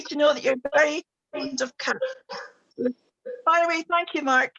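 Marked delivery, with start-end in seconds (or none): none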